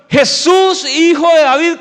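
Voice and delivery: high, loud cry for help; desperate shout. Acted. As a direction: call out to Jesus with everything you have. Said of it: "con todas las fuerzas de su voz". (grito desesperado a todo pulmón)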